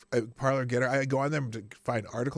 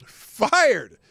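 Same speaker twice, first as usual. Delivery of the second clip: FIRED belted out loud and high; 「Fired」と叫ぶように強調; emphatic shout; 8 semitones higher, 9 dB louder than usual. Shown as a demonstration. Repeats trending word louder.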